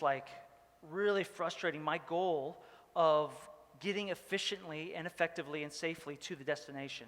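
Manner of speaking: ordinary talk, nothing marked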